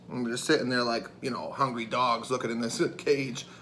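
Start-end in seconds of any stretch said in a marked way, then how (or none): none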